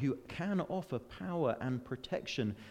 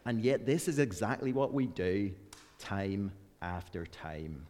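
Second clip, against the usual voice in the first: Northern Irish accent; 2 semitones lower than usual. Shown as a demonstration. Preacher imitating a Northern Irish writer, Northern Irish accent. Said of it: "so much better in Northern Irish".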